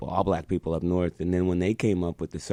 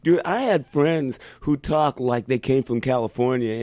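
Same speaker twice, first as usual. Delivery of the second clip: ordinary delivery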